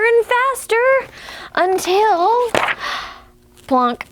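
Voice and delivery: lively storybook reading; animated picture-book narration; reading to children. Acted. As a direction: read it like storytime for little ones.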